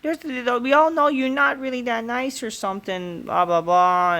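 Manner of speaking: mock diva taunt; high mock woman's voice. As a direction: squeal the taunt mockingly. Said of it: "Blake Monroe".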